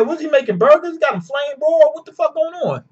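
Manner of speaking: high-pitched, baffled impersonation; mock incredulity. Sparrow puzzled by the flames, high mock incredulity. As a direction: question it in a high, disbelieving voice.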